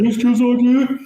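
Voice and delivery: high, loud mock-recruit holler; Forrest Gump. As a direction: shout it as a comically eager, obedient recruit. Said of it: "literally like Forrest Gump".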